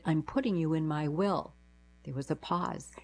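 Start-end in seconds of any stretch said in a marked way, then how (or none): none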